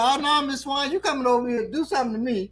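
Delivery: high, quick mock-fearful protest; hammed-up nervous refusal; alarmed neighbour. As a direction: protest in a high, worried comic voice. Neighbour fears mother's revenge.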